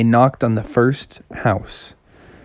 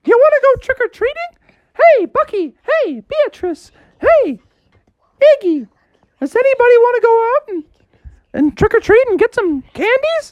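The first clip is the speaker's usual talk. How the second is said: much higher squeaky cartoon mouse; Mickey Mouse voice. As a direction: play Mickey Mouse greeting the kids. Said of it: "It's me, Mickey Mouse".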